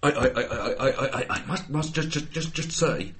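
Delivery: stammering, bumbling English gent; soft, halting stutter that climbs in pitch; Hugh Grant. Acted. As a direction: stutter hesitantly on every word before getting it out. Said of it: "That's my Hugh Grant".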